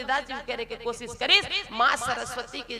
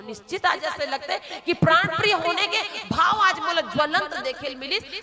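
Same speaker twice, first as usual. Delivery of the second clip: ordinary talk, nothing marked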